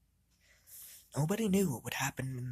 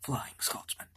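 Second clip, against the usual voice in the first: softer, breathy whispered name; hushed whisper; 2 semitones lower, 5 dB quieter than usual. Acted. Gordon naming his only living brother, whispered. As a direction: say the name like a secret. Secretive talk about dead brothers.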